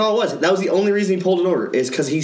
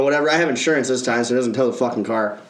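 ordinary talk

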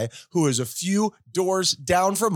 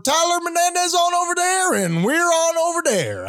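put-on Southern accent; 11 semitones higher than usual. Host opens the show in hammed-up Southern accent. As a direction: greet like an over-the-top Southern radio host. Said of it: "when you ever do your Southern accent".

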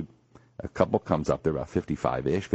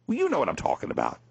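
ordinary talk

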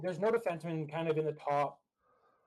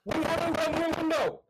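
high, raised-voice exasperation; yelled protest; 9 semitones higher, 3 dB louder than usual. Real, not acted. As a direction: yell it in real disbelief, pitched way up. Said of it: "you just went staticky there when you yelled".

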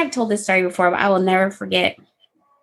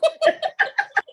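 high laugh. Real, not acted. Laughing at her own bit.

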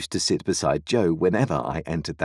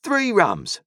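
cried out; 14 semitones higher, 4 dB louder than usual. A narrator's reading. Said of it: "cried the stranger".

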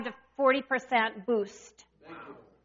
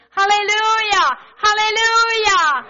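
breaking loose, higher and louder, drawn out; shouted hallelujah; 9 semitones higher, 8 dB louder than usual. Retold or imitated. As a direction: let it burst out at full voice. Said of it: "you might have to shout".

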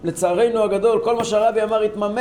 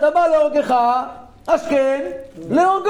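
proclaiming; 5 semitones higher than usual. Real, not acted. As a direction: hammer each word out. Preacher proclaims the verse again.

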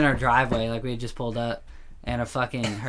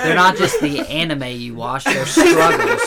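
mock earnest; 13 semitones higher, 12 dB louder than usual.

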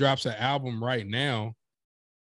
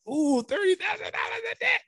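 awestruck. Retold or imitated. Imitating past awed self.

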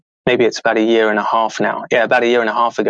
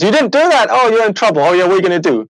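much higher-pitched imitation of a telling-off; mock scolding coach; 7 semitones higher, 3 dB louder, faster than usual. Coach catching a client who skipped the work, mock scolding. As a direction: scold in a put-on, high voice.

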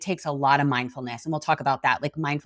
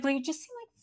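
high, soft imitated reply; tentative softening; 11 semitones higher, 9 dB quieter, faster than usual. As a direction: break it to mom gently, higher and softer. Son's gentle answer acted out.

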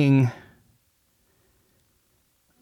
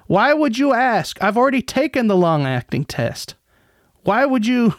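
2 higher, hammed-up outraged defendant; 3 parody his contempt, climbing higher with each why; 1 mocking indignant rant; 6 semitones higher than usual. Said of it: "It was, you know".

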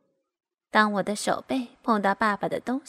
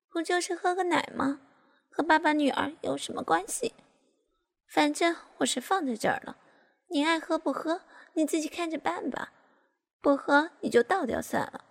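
higher-pitched, offhand retort; dismissive; 6 semitones higher than usual. A narrator's reading. Grown daughter brushing off father's objection, dismissive. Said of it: "我不以为然地说".

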